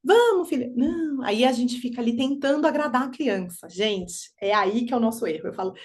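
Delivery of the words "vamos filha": coaxing parent. Retold or imitated.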